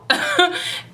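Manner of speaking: brief high-pitched laugh; short laugh. Real, not acted. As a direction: let out a quick high chuckle. Laughs while retelling the phone greetings.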